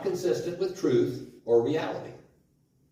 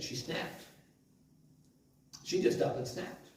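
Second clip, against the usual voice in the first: whispered; 7 dB quieter than usual. Real, not acted. Preacher hushes for effect.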